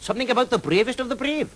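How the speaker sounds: high, lilting Welsh dragon voice, friendly and teasing; a jolly Welsh dragon drawing out his words. Acted. Welsh dragon Mister Jones.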